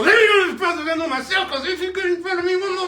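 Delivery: loud, high, fast furious yell; angry shout. Pretend. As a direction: yell it like you're fed up. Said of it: "a lot of energy behind".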